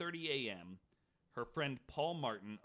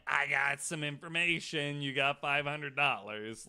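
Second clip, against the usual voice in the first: loud, pushy imitation of a reward chaser; louder mock tipster demanding the reward; mock greedy tipster; 9 dB louder than usual. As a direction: ham up a chancer cashing in a tip. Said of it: "anybody coming in like".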